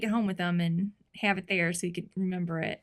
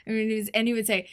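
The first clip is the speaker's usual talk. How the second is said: ordinary talk, nothing marked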